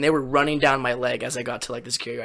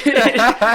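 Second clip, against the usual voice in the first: laugh; 7 semitones higher, 5 dB louder than usual. Real, not acted. Laughs at his own story.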